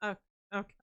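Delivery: resigned, hushed oh okay; meek acceptance. Retold or imitated. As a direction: quietly swallow the annoyance. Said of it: "I was just like".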